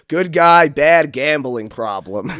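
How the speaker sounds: loud, then slow on the punchline; punchline comeback. Retold or imitated. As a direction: land the last words slowly. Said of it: "And I was like".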